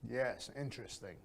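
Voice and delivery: fake interest. Shown as a demonstration. Teacher showing a fake, bored reply. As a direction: let "Yes, interesting" drop low and dull. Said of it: "That just sounds fake".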